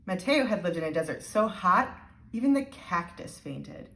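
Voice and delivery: ordinary delivery, nothing marked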